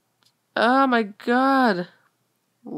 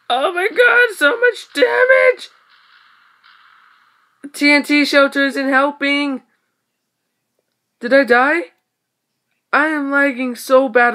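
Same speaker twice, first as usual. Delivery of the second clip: much higher, louder shriek; panicked yell; 7 semitones higher, 6 dB louder than usual. Real, not acted. Gamer shrieking as TNT damage piles up. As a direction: cry out in alarm. Taking heavy damage in game.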